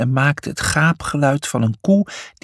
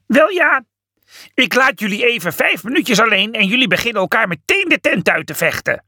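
very high, cocky voice; cheeky antelope; 10 semitones higher than usual. A narrator's reading. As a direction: breeze in teasing them. Felix arrives cheeky.

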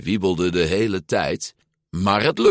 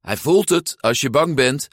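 stern coaching in a raised pitch; strict instructor, pitched up; 7 semitones higher, 4 dB louder than usual. A narrator's reading. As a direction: lay down the rule sternly. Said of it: "zei Alberto streng".

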